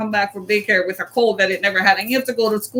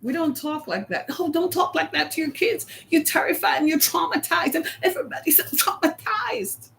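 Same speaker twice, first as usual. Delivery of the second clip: mocking whine; 4 semitones higher, 7 dB quieter than usual. Mocking soft-parenting critics.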